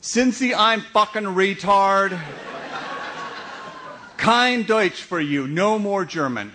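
hammed-up outrage at him; exasperated publicist. Publicist banning the comedian from German, mock outrage. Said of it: "Susanna is saying".

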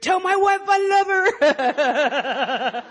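high, very slow, wavering death wail; hammed-up dying farewell, quavering at the end; boy's dying soldier. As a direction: draw out the last words in mock agony. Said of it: "he'd be like".

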